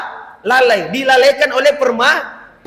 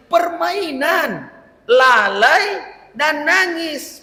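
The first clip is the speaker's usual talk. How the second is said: slow listing; 3 semitones higher, much slower than usual. Shown as a demonstration. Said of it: "summarising three points slowly".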